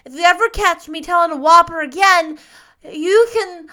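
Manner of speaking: put-on high, loud small-boy vow; Davy, earnestly vowing. A narrator's reading. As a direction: pledge it with childish fervor. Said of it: "asseverated Davy between sobs".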